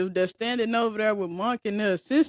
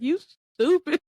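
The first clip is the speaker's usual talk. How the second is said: mock incredulous old man; 8 semitones higher, 4 dB louder than usual. Voicing the old man's look.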